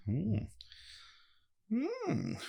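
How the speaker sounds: high, soft appreciative hum; tasting hum. Real, not acted. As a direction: hum while savouring a sip. Tasting mead for the first time.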